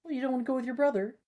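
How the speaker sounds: high, fast quizzical voice; student teasing about the brother. Acted. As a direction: poke fun at the choice of partner. Voicing a student character.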